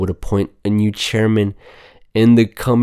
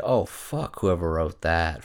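normal talking voice

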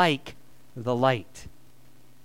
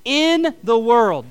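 slow, loud, every word leaned on; slow call and response; 5 semitones higher, 7 dB louder, much slower than usual. Shown as a demonstration. Congregation repeating after pastor, slow emphatic response. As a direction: drag it out with the congregation. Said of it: "Say that with me one more time".

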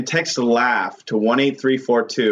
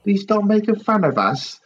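mock pleading North Korea; 9 semitones higher than usual. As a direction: beg in a silly high voice while laughing. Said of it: "when North Korea sends me a message".

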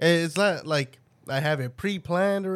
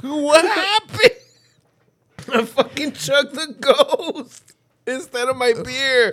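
sour mouth; 8 semitones higher, 4 dB louder, slower than usual. Real, not acted. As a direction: cut off by a sour drink. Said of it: "That motherfucker was sour".

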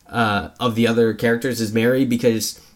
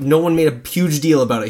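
ordinary talk, nothing marked

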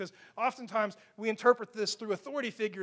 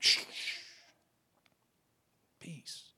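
soft, unvoiced shush to the storm; understated hushing; 6 semitones lower, 6 dB quieter, slower than usual. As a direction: barely whisper it, casually calming. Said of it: "sat up on the cushion and was like".

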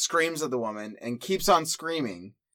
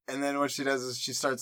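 ordinary talk

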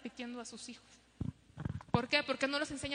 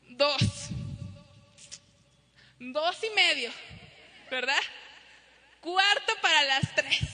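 louder, slow counting to three; drawn-out mom count; 6 dB louder, slower than usual. Retold or imitated. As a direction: stretch the count out like a fed-up mom. Parent counting slowly. (mamá contando lento y fuerte)

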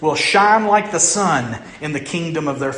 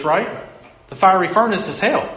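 normal talking voice

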